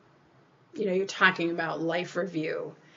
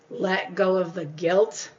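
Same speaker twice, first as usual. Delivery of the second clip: slow mantra; slower than usual. Shown as a demonstration. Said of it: "repeated slow mantra".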